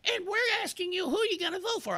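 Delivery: fast, high falsetto mimicry; campaign texter. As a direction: rattle off the question in a squeaky mock voice. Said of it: "he said".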